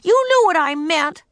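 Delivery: put-on high, loud angry child; angry shout. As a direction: yell it at him. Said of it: "shouted Ramona".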